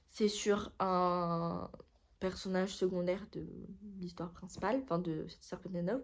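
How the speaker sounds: ordinary delivery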